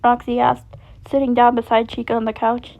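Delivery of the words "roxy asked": usual speaking voice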